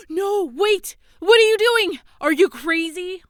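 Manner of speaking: high, loud panicked cry to stop her; alarmed shout. Acted. Alarmed friend shouting to stop the crazy girl, panicked yell. Sudden alarm at a rash act.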